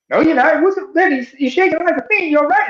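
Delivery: high concerned voice; voicing the boyfriend. Retelling the skit, boyfriend asks his girlfriend, high. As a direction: ask it high and worried. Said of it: "she said / he's looking".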